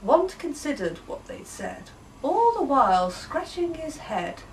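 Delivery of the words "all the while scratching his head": ordinary delivery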